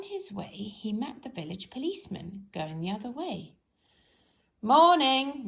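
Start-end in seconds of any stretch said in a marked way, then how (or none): 4.6-5.5 loud booming good morning; hearty greeting. Acted. Village policeman greeting Mister Forgetful, loud and hearty. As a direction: boom it out like a jolly bobby.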